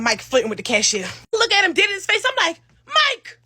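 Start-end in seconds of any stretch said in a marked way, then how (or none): 2.8-3.4 high loud call of a name; high shouted name. Retold or imitated. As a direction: call across the room at him. Calling friend across restaurant.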